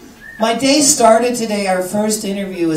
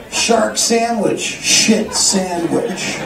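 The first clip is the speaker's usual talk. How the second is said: slow, drawn-out deadpan; slow mock critic's verdict; mock review quote; much slower than usual. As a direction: drag out each sandwich with dry disdain. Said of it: "That's like".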